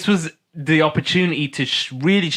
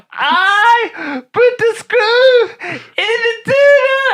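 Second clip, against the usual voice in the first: much higher, louder slow yell; mock Kel confession; 6 dB louder, much slower than usual. Kel confessing in court, high slow yell. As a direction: blurt the confession in a wail.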